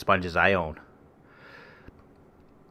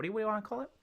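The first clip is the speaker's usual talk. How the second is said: rapid high babble; 12 semitones higher, 8 dB quieter, much faster than usual. Real, not acted. Rambling about the foam cover.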